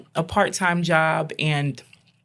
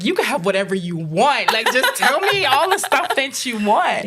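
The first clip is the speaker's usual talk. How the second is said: high, laughing, a bit louder promise; overjoyed; 8 semitones higher, 4 dB louder than usual. Retold or imitated. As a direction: gush with delight at your child. Overjoyed mom to talking son.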